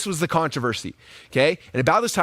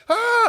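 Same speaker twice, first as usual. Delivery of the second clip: mock pop-up shriek; 4 dB louder than usual. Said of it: "be like".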